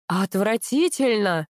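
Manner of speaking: slow, drawn-out indignation; angry. A narrator's reading. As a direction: stretch the word in disgusted anger. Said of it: "гневалась Капа".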